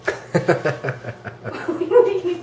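high-pitched fit of laughter; real helpless laugh. Real, not acted. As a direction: burst out laughing. Laughing at the presenter's sales line.

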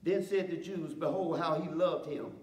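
measured, slowed Bible reading; scripture reading. Acted. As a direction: read the passage with weight. Reads scripture aloud.

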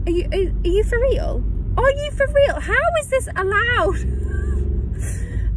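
building to high-pitched disbelief; incredulous. Retold or imitated. Couple listening to the radio DJ, acted incredulity.

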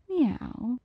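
playful meow; cat meow. Acted. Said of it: "didn't expect to be meowed at".

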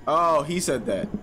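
normal talking voice

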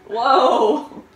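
loud surprised laugh. Real, not acted. Laughs at flubbed line.

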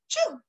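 high sneeze; howling sneeze. Acted. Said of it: "howling sneeze".

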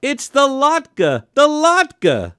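very high, slow excited shriek. A narrator's reading. Aunt spotting the latke on TV, shrieking. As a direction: shriek in astonished recognition. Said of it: "my Aunt Etta shrieked".

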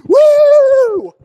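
high whooping mock celebration; celebrating player. Mocking football players who over-celebrate, whooping loud. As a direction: whoop it up like a showboating receiver. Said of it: "they're like".

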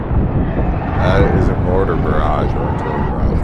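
loud squad leader call; shouted orders. Real, not acted. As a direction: bark it out to the squad.

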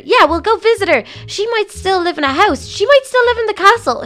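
hopeful excitement. Acted. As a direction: let the dream lift the voice. Daydreaming hopefully about her sister.

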